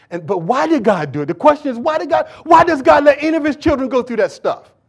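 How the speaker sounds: high, loud impassioned questioning; full-force preaching. Real, not acted. Challenging the congregation on why God allows suffering, loud and fervent. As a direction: thunder rhetorical questions at the congregation.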